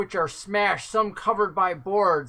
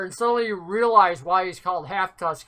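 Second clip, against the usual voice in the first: ordinary talk, nothing marked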